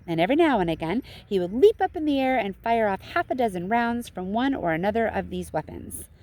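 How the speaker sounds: ordinary delivery, nothing marked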